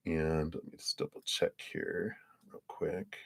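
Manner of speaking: a soft aside whispered to herself. Real, not acted. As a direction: drop to a whisper as if checking notes alone. Whispered aside while checking cards.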